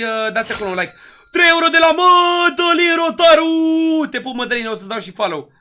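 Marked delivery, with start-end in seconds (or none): 1.3-4.1 high, loud, long-held announcer yell; hyped donation shout. Real, not acted. Streamer announces three euros from donor, drawn-out hype shout. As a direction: stretch the donor's name into a big yell.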